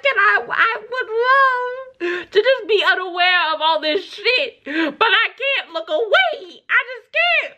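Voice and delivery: high, mock-emotional singer voice; voicing the singer. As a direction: voice her high and half-crying. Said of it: "she's like".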